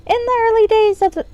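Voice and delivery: high-pitched pixie reading aloud. Acted. Game player reading a found tome aloud, pixie voice. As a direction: read it as a high little pixie. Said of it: "done with the pixie voice".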